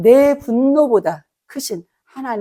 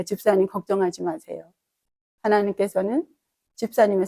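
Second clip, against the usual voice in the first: soft, lower comforting voice; gentle comfort; 5 semitones lower, 7 dB quieter than usual. Retold or imitated. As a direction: reassure her gently. Pastor comforting apologetic deacon. (사과하는 집사님을 부드럽게 위로하며)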